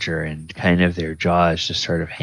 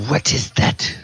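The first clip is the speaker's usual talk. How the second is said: ogress voice; 4 semitones higher, faster than usual. Acted. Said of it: "she says".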